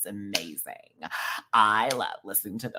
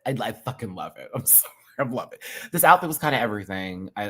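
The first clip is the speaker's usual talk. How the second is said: ordinary talk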